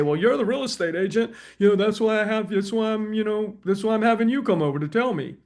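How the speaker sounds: lightly voiced client; seller's reply. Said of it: "they say".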